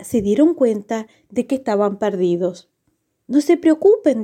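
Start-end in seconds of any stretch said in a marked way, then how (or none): 3.3-4.2 high little Pulgarcito voice; tiny hero reassuring. A narrator's reading. Pulgarcito to his lost brothers, high and reassuring. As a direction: reassure them in a small, high voice. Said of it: "dijo Pulgarcito".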